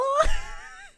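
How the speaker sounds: high, squealing burst of laughter; shrieking laugh. Real, not acted. Streamer laughing at the clown song, high squealing laugh. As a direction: laugh it off in shock.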